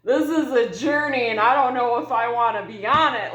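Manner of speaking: high and loud, half-laughing oh no; playing her own overwhelmed self; high, loud mock dread. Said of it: "I was like".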